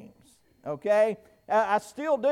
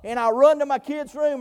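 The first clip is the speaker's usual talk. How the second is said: normal talking voice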